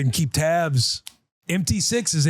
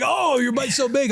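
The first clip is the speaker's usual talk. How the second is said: much higher, exaggerated wonder; mock amazed praise; 11 semitones higher than usual. Dad gushing over his daughter's big bite, much higher. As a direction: gush over it like a kid's trick.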